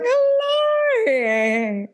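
very high, long drawn-out mock hello; Lauren impression. Co-host showing how Lauren would say it, exaggerated accent. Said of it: "she'll be like".

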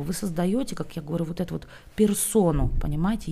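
ordinary delivery

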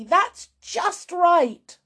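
high, drawn-out cry. A narrator's reading. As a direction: call it out slowly and urgently. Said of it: "cried Missus Cranky".

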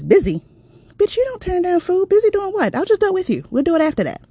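loud, high, bossy friend voice; pushy friend. Retold or imitated. Friend on the phone barking orders in a high, loud voice. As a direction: boss her around like a brash best friend. Friend D refuses her excuse.

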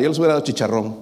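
ordinary delivery

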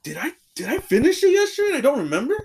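high-pitched baffled self-questioning; retold bewilderment. Man doubting his memory after checking the trash, bewildered.

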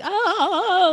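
high loud overacted cry; mock scream. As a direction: belt it like a porn actress who doesn't care who hears. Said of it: "like, screaming in the".

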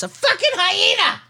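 high, incredulous yell of hyena; exasperated outburst. Real, not acted. Host ranting at the teen who reached in, incredulous yell. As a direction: yell it like it's obvious.

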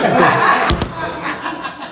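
high burst of laughter; hearty high laugh; big laugh. Real, not acted. Punchline of wife's story.

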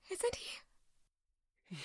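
soft unvoiced whisper. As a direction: breathe it out in a whisper. Repeated line whispered.

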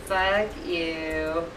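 mock blunt kiss-off.

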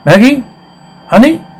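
high, rising questioning calls; searching call. Acted. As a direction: coax a hidden child with high questions. Coaxing the missing child out.

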